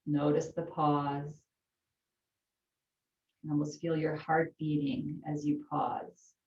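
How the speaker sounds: calm yoga teacher voice; unhurried breathing instructions; guided breathing. Acted. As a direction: talk them gently through the breath.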